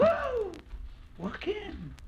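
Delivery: a woman wowed by her caller's fine clothes; woman's whoa. Retold or imitated. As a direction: squeal it like a dazzled woman at the door. Woman greets dressed-up suitor.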